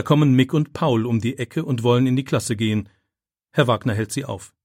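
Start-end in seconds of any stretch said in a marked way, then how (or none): none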